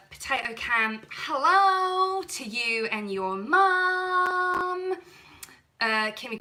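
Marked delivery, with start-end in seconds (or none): 1.1-4.9 high, slow cooing greeting; high and very slow sweet greeting; sing-song hello. Real, not acted. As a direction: coo a hello to a viewer.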